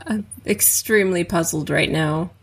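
ordinary talk

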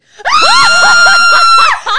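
long, piercing, very high scream; ecstatic scream. Real, not acted. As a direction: scream with delight at a surprise gift. Opens the surprise birthday shirt.

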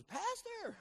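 high call of "Pastor!" to get attention; shouted "Pastor!". Retold or imitated. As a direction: call out to the pastor from the front seat. Driver calls out to pastor.

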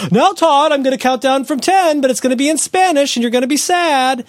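high, loud hammed-up parental threat; mock yelling parent. Parent threatening Todd with a countdown, high mock yell. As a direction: yell it like a parent losing control. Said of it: "You don't wanna do the yelling".

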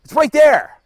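"It's right there!" shrieked high and loud; panicked shout. Pretend.

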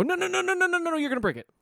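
high frantic pleading; panicked no. Retold or imitated. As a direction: stop them in a panic, high. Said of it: "I have to go".

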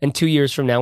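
usual speaking voice

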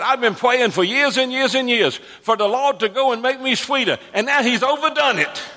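high, excited tongue-tied preacher imitation; Uncle Buddy Robinson. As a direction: build to a comic punchline in a high, thick-tongued voice. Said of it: "Uncle Buddy was tongue-tied".

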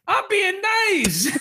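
high, very drawn-out mock insistence; comic sing-song protest; exaggerated protesting niceness. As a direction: stretch every word high in hammed-up innocence. Acts out insisting he was nice.

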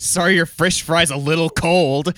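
high, slow whiny complaint; mocking a whiny customer. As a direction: whine like a spoiled customer.

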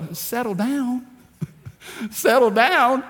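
scoffing, disbelieving repeat; incredulous. Real, not acted. Preacher scoffing at the idea of settling down, incredulous. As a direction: pitch it up in mock disbelief.

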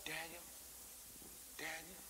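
soft, almost toneless "Daniel, Daniel"; limp quiet calling. Shown as a demonstration. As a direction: say "Daniel" weakly, with no feeling. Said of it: "he wasn't like".

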